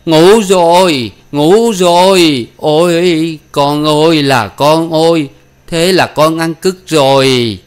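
slow, loud, high lament; drawn-out moaning of a ruined father; piteous wailing. Acted. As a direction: wail each word out in misery. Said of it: "Lão rên lên những lời thảm thiết".

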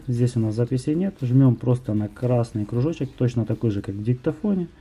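usual speaking voice

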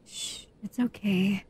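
normal talking voice

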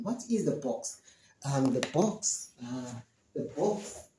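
murmured, searching for the word; quiet puzzled musing; softly thinking aloud. Shown as a demonstration. Student searches memory for the word, muttering softly. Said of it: "Then you start thinking".

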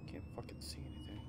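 unvoiced whispered complaint. Real, not acted. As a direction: whisper in the dark. Dark scene.

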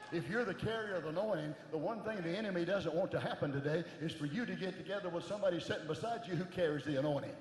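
preaching that swells from steady to full-force shouting; building preaching. Acted. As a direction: build the congregation up until you're roaring.

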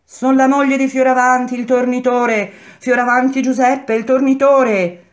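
high, loud roll-call cry; woman shouting. A narrator's reading. Turner's wife to her jailed husband, shouting. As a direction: call the name out to the prison. Wives calling to jailed men.

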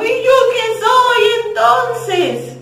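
high, slow sing-song jealous whine; mock crying. Jealous number two asking the pair, high slow whine. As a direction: whine it like a jealous little kid. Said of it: "se puso a llorar".

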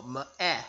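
"m-eh" with the vowel stretched as a short eh; short a sound demo. Shown as a demonstration. Teacher showing students the short a in math. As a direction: draw out the eh in "m-eh". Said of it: "short A sounds eh".

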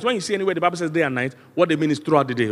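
normal talking voice

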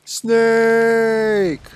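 high-pitched call across the ship. Real, not acted. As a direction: call out the name, stretched. Calling the snake character over.

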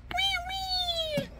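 high, soft double squeal; baby doll cry. Pretend. Voicing the other baby doll, high soft fake cry. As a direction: squeal two small pretend wails. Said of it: "The other baby go".

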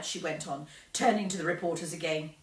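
ordinary delivery, nothing marked

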